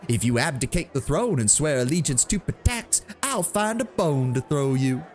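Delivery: theatrical scornful jeer; sneering bard. Acted. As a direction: taunt the shabby hero in front of the feast. Half-elf bard mocks hero.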